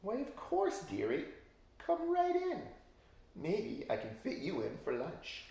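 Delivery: high sly witch voice, sweet and scheming; sugary witch invitation. Acted. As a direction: lure him in like a cackling crone.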